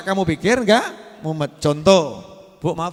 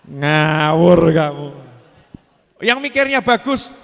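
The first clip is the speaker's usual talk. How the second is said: slow, louder, lower scolding; mock scolding; 3 semitones lower, 7 dB louder, slower than usual. Preacher mock-scolding a foolish listener, slow and stern. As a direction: tell them off slowly.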